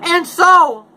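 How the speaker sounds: high, loud little-creature voice; Lorax voice. Acted. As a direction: squeak it out like the fussy Lorax. Lorax speaking.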